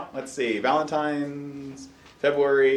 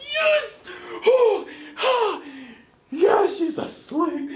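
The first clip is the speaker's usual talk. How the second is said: triumphant cry; 13 semitones higher, much slower than usual. Retold or imitated. Parent cheering as the baby finally sleeps, shouted joy. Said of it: "I'm like".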